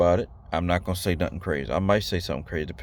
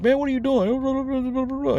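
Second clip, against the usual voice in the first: much higher mock yell of an angry man; mock screaming guy. Vlogger mimicking someone screaming at him, high mock yell. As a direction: squeak out a ranting guy's nagging, hammed-up. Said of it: "like, screaming at you".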